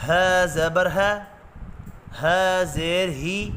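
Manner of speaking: slow, spelled-out Qaida drill; syllable drill. Shown as a demonstration. Teacher drilling the class through Noorani Qaida syllables. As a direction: spell each letter with its harakat slowly.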